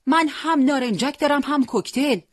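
yelled high and loud; shouting. Acted. Boy shouts across the alley to the fighter, loud. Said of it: "فریاد زد".

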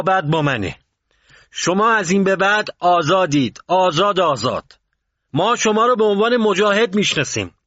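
loud, high-pitched proclamation; loud shout. A narrator's reading. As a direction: shout it out to the whole crowd. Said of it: "با صدای بلند فریاد زد".

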